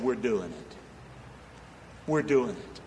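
low and soft, steady; quiet resolve. Retold or imitated. Preacher retelling his answer to the builder, low and soft. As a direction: say it softly but firm.

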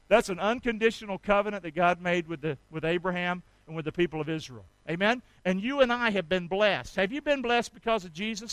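ordinary talk, nothing marked

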